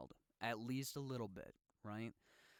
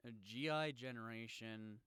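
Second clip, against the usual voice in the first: normal talking voice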